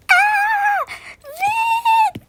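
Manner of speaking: hurlement très aigu, paniqué; cri de terreur. Acted. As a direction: crier de peur, haut perché. (femme hurlant de peur face au meurtrier)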